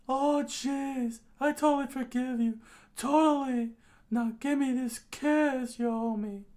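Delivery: very high, loud character voice; high-pitched, excited forgiveness; eager kiss-seeker. Acted. Plays Denji in story.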